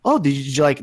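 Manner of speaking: ordinary talk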